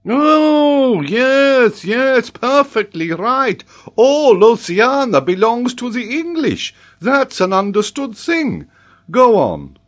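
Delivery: loud, very high, drawn-out mock assent; sarcastic agreement. A narrator's reading. Geographer pretends to accept the boy's answer, loudly sarcastic. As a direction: agree with exasperated exaggeration.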